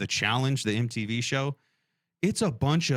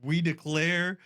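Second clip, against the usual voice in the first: movie president speech; 7 semitones higher than usual. Retold or imitated. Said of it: "Or Independence Day".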